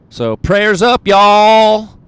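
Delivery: high, loud hype call to the viewers; hollered sign-off, half joking; rallying call. Acted. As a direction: whip up the crowd with a big send-off.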